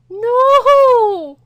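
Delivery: sharp gasp, then long high scream of shock; very loud, drawn-out high cry; horrified scream. Real, not acted. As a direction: scream out in real horror. Horrified at the dead cat.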